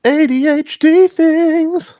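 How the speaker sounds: high, slow, drawn-out teasing; sing-song tease. Pretend. Teasing remark.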